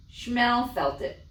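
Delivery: ordinary delivery, nothing marked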